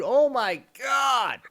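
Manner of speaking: normal talking voice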